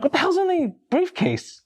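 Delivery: high-pitched, incredulous question; baffled demand. Retold or imitated. Boss questioning new hire's briefcase in the office, baffled. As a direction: act out comic bewilderment.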